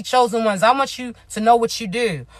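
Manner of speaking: ordinary talk, nothing marked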